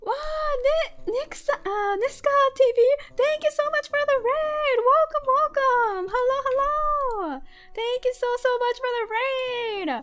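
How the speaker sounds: high, bright, loud welcome; excited greeting. Real, not acted. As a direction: gush over the new raiders.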